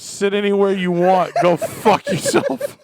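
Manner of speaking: high, loud waitress brush-off; hammed-up, climbing to a laughing high finish; mock rude waitress. Waitress seating a drunk customer, hammed-up rude.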